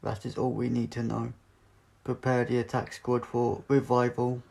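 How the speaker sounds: loud, flat robotic commands; a machine giving cold orders; Cyber leader. Acted. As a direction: metallic voice ordering the troops.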